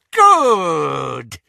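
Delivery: a leering witch, lightly voiced. A narrator's reading. Bellatrix gloating over her captives, leering. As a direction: lean into the word with a sneer. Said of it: "she leered".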